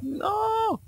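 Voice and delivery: high frantic shouting; panicked yell. Real, not acted. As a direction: beg him to back off. Chased by psychopath, yells. (crie paniqué et aigu)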